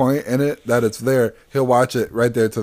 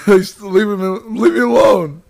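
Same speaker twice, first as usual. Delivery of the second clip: laughing protest; 6 semitones higher, 4 dB louder, slower than usual. Real, not acted.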